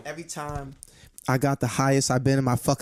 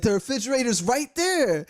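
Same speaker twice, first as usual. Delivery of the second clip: higher, slow, offhand imitation of the drunk friend; dismissive drunk friend; 9 semitones higher than usual. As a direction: brush him off, pitched up and dragged out. Drunk friend voiced in story.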